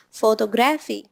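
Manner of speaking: put-on photography mispronounced with a Middle Eastern accent, GRAPH stressed. Teacher imitating a student from the Middle East, Middle Eastern accent. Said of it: "A student from the Middle East".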